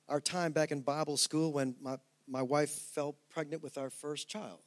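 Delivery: ordinary talk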